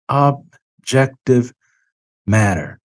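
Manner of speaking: OBJECTIVE pulled apart syllable by syllable; slow, spaced-out OBJECTIVE; deliberate emphasis. Real, not acted. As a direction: say OBJECTIVE very slowly, pausing between syllables. Key word stressed again.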